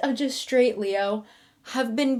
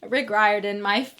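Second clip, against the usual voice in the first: ordinary delivery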